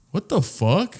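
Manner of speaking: high-pitched, baffled cat; voiced cat. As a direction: speak up high as an affronted pet. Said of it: "my cat's like".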